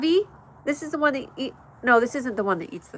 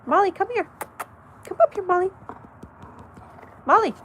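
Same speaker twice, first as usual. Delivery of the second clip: calling dog; 10 semitones higher, 4 dB louder, faster than usual. Real, not acted.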